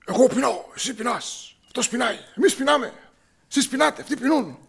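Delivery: high, loud, agitated conjugation; nervous. Retold or imitated. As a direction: rattle the verbs off like a jittery, nervous kid. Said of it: "Όλοι μας στην οικογένεια είμαστε νευρικοί".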